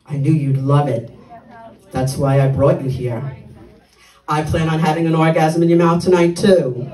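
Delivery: put-on low man's voice; low mock male voice for a date's reply; imitating male date. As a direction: play the suitor, pitched well down.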